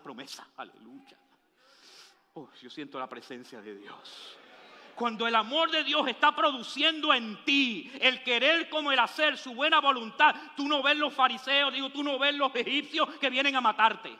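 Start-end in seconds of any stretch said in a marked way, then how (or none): 4.9-14.2 loud, driving sermon cadence; preaching at full force. Acted. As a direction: preach it out to the congregation. (predicando con toda la fuerza)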